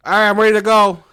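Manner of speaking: hammed-up high, very loud yell; energetic hype yell. High comic backstage before going on, energetic yell. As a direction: burst out like an overhyped comic backstage. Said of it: "he's gonna be up on that bitch energetic".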